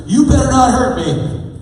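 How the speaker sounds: high, loud, slow bratty warning; threatening teen girl. Retold or imitated. Teen patient warning the chiropractor, high slow bratty threat. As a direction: draw it out like a defiant teenager.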